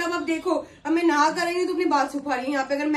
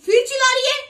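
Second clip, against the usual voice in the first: much higher, loud angry complaint; angry shout; 9 semitones higher, 7 dB louder than usual. Acted. Daughter yells back at her mother mid-quarrel.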